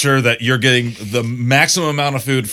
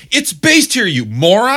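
loud, much higher mock outburst; exasperated insult; 11 semitones higher, 7 dB louder, slower than usual. Scolding people who say Myers, loud and high. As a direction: yell it at the fool. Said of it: "And it's like".

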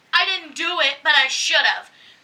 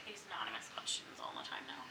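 whisper; 12 semitones lower, 13 dB quieter than usual. Real, not acted. Trailing aside about questions.

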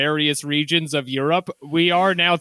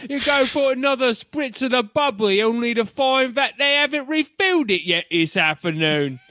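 higher-pitched comic Cockney; Cockney accent; 7 semitones higher than usual. Acted. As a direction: grumble like a cartoon Cockney ragamuffin. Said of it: "I can't do the accent".

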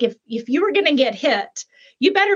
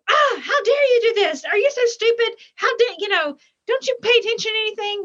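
mock angry yell at kids; 11 semitones higher than usual. Father mock yelling at his kids over spilled milk, furious. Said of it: "But it's like, if I".

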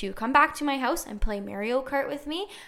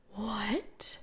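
stunned whisper; 12 dB quieter than usual. Acted. Stunned by his reply.

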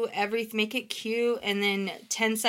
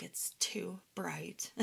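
slow, soft, whispered complaint; imitating a depressed friend whispering slowly from bed; imitating a weary whisper; 10 dB quieter, much slower than usual. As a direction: breathe it out slowly, worn out. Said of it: "She's like".

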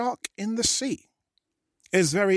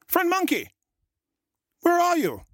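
even higher, more plaintive shark call; shark shouting. A narrator's reading. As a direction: call out again, higher and lost. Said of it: "Then he shouted".

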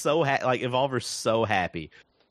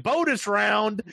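slow, higher mock announcer; mock robot line; 8 semitones higher, slower than usual. Retelling robot announcing a new round, slow mock announcer. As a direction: drag out the game-show catchphrase. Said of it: "He's like".

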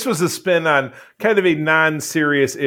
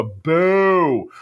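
drawn-out, higher boo; mock booing; 4 semitones higher than usual. Host heckling Wikipedia or Memory Alpha, drawn-out mock boo. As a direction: jeer with one long vowel.

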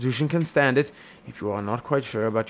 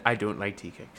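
normal talking voice

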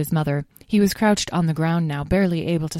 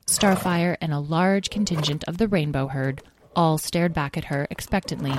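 usual speaking voice

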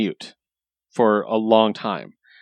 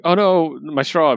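higher-pitched little "oh no"; mock dismay; 5 semitones higher, slower than usual. Guy who forgot a straw, lightly acted dismay. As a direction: imitate a goofy guy's mild alarm. Acting out goofy guy's small mishap.

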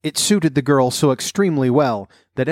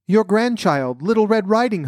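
wolf imitating a little girl, much higher; counterfeit child's voice; 9 semitones higher than usual. A narrator's reading. Wolf poses as the grandchild at the door, counterfeit high voice. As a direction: put on a high-pitched granddaughter voice. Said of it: "counterfeiting her voice".

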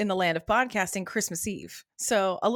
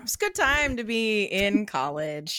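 normal talking voice

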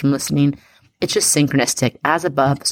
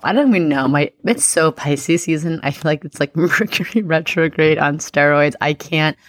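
usual speaking voice

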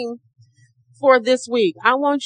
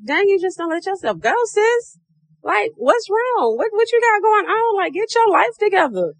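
put-on high sassy girlfriend scolding; 9 semitones higher than usual. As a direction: call yourself out, sassy and high. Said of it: "looking at me and be like".

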